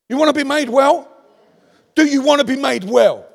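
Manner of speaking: re-enacted preacher challenge.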